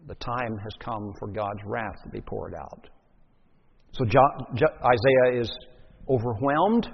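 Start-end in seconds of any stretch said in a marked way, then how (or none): 0.1-2.9 hushed, low pronouncement of doom; grave warning. Acted. Preacher quoting the coming of God's wrath, grave and hushed. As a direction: drop right down, soft and ominous.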